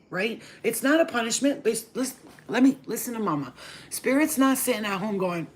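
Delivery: normal talking voice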